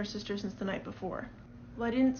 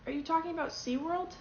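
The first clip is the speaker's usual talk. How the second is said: ordinary delivery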